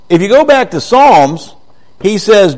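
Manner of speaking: usual speaking voice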